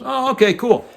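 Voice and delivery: high, bright mock young guy's "okay, cool"; impressed cable guy. Cable guy answers the pilot's advice, high and impressed. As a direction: lightly play the young guy taking in the news. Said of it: "And he goes".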